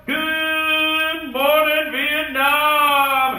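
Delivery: long, drawn-out DJ bellow; Good Morning Vietnam call. Retold or imitated. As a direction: stretch it like the radio greeting. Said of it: "Little flashback to Good Morning Vietnam".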